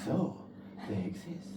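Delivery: quiet wondering mother; mom's slow realization. Retold or imitated. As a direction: softly marvel that they're real. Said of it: "the mom says".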